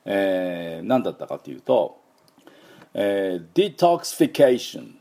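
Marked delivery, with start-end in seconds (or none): 3.5-4.8 "デトックスフィケーション" as dee-tahks-fi-KAY-shun; higher, careful English-accented "デトックスフィケーション"; English word demo. Shown as a demonstration. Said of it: "元々の単語はですね".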